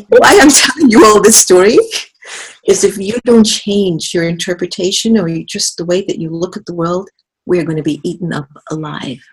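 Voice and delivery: usual speaking voice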